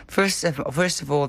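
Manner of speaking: ordinary delivery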